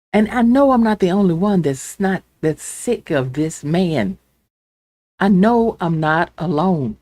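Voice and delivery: normal talking voice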